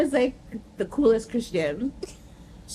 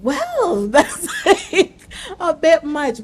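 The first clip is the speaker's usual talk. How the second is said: laughing; 7 semitones higher, 5 dB louder, much slower than usual. Retold or imitated. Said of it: "she was like".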